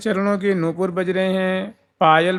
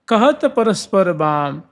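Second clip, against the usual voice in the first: ordinary talk, nothing marked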